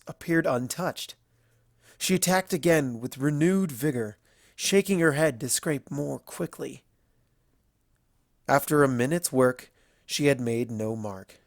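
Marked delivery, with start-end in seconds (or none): none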